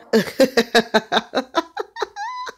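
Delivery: real high, rising laugh; long cackling laugh climbing in pitch. Real, not acted. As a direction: break into a long laugh at your own retort. Laughs at own retort.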